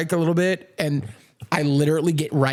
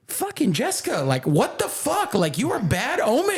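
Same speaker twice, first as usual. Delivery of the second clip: much higher, exasperated mock rant; high-pitched mock indignation at a friend; mock outrage; 11 semitones higher than usual. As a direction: whine it up high like you're fed up. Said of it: "And I was like".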